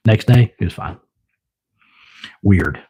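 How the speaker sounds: ordinary talk, nothing marked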